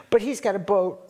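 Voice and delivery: usual speaking voice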